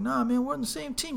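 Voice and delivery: high-pitched backing down; mock placating. Guy backs down before the friend, placating high voice. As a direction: plead peace in a put-on high voice.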